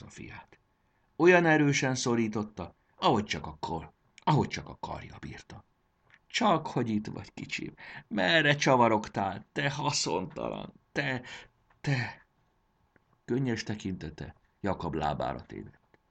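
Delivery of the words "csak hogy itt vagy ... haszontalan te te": high, halting, tender scolding; emotional scolding. A narrator's reading. Mother holds her returned son and scolds him, moved. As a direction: scold lovingly through mild tearfulness.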